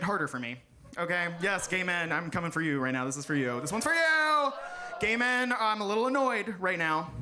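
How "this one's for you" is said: high, raised call to the crowd; sudden high-pitched shout-out. Real, not acted. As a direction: holler the dedication out.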